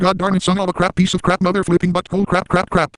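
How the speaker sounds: high-pitched mock meltdown, cursing; spaz rant. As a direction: spit out the fake swears in a squeaky fit. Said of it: "that unnecessary spaz right there".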